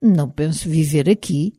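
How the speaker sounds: ordinary delivery, nothing marked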